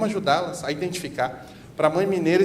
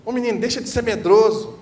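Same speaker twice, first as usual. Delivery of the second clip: put-on higher, louder scolding of a boy; harsh scolding; 5 semitones higher, 6 dB louder than usual. Adult telling a frightened boy that men don't cry, harsh scolding. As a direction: shut the crying boy down. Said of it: "Ao invés de a criança escutar".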